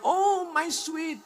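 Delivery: slow, high, soft mock sweetness; mock tender. Preacher mocks a widow's sweet words, slow and soft. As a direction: coo it like a syrupy lover, teasing.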